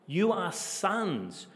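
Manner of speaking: sons said slowly with a falling tune; stress on sons. Shown as a demonstration. Preacher to the congregation, pressing the word sons. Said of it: "emphasize the word son".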